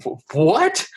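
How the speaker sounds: a quick, hammed-up stunned "what?"; mock disbelief. People in the past hear the prediction, in mock disbelief. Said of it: "they're gonna be like".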